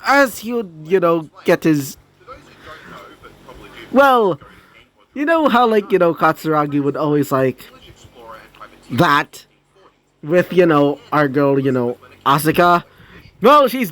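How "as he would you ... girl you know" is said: plain reading voice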